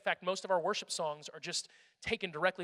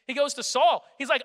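excited; 7 semitones higher, 9 dB louder than usual. Real, not acted. Excited storytelling.

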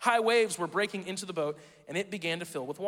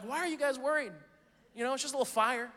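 mock nonchalant parent; 3 semitones higher than usual. Parent shrugging off house fire.

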